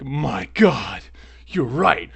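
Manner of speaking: loud, high, hammed-up epiphany; stunned realization. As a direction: play the officer struck by a sudden revelation. Officer's acted stunned realization.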